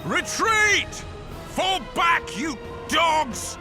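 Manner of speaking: high, furious battlefield yell; shouted retreat order. Acted. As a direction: yell it across the field at your troops. General calls his troops to retreat.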